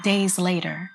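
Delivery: ordinary delivery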